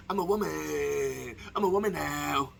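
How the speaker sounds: hammed-up woman voice, drawn-out words; mock woman voice. Vlogger mocking the equality argument, put-on woman voice. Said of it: "Got my voice scratchy in my woman voice".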